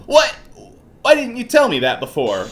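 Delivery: whiny protest in a put-on voice; Larry. Game character protests to the investigator, indignant. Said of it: "doing the Larry voice".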